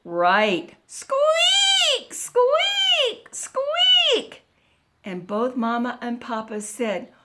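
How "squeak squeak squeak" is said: high-pitched drawn-out bed squeaks; squeaky bed. A narrator's reading. Storyteller voices the creaky bed for listeners, high squeaks. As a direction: squeal each squeak up high like old springs. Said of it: "What do you think the bed did?".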